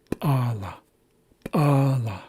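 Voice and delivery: "p'ala" with an ejective, popping p; popped P. Shown as a demonstration. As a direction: pop the p, then release "ala". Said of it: "you can hear that popping sound".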